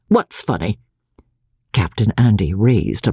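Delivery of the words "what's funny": understated, challenging growl of a question; mildly belligerent. A narrator's reading. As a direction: challenge them curtly. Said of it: "his tone belligerent".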